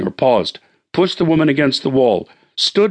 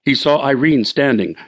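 plain reading voice

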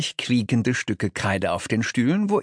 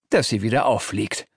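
ordinary delivery, nothing marked

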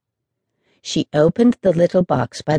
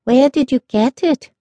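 put-on higher, childlike eager question; curious children; 8 semitones higher than usual.

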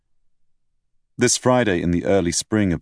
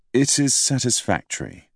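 unconvinced; 6 semitones higher than usual. A narrator's reading. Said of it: "my tone of voice said otherwise".

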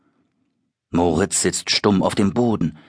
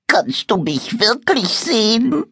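hohe, klägliche Monsterstimme; kläglich. Acted. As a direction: kleinlaut und jämmerlich fragen. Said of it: "fragt es kläglich". (das Monster fragt Moritz kläglich, hoch und verzagt)

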